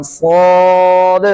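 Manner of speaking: long सौद released with a bouncing d; echoing final d. Shown as a demonstration. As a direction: hold सौद, then let the d sound out. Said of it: "दाल की आवाज़ मैं लेकर आ रहा हूँ". (सौद की दाल पर कलकला)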